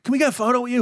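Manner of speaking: high eager fan voice asking for a photo; imitating a fan. Girl on the street asks him for a photo, eager and high. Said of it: "they'll come up and like".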